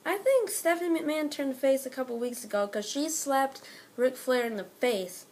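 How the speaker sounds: ordinary talk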